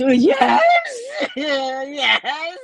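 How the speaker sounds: loud, high mock sobbing yes; hammed-up tearful squeal; crying child. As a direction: wail it like a bawling kid. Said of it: "mom can really calm him down".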